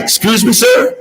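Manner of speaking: can't believe what he just heard; stunned disbelief. Retold or imitated. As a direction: pitch jumps high on sir. Told to just go ask.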